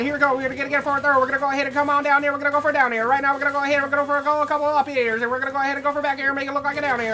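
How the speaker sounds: rapid-fire auctioneer patter; fast auction chant. Acted. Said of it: "I should have been an auctioneer".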